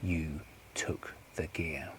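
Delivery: very slow, hushed, word by word; drawn-out, quiet threat; slow menacing accusation. Acted. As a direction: space out each word, low in volume. Accusation repeated slowly for menace.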